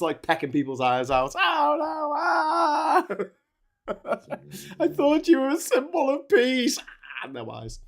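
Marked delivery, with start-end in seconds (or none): 1.4-3.1 high crying shout; mock scream of pain. Victim crying out. 4.8-6.8 high, whiny wounded protest; betrayed victim voice. Pretend.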